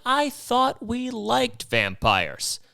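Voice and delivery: playing dumb, drawn out; feigned confusion, high and slow; mock innocent. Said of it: "And I'm like".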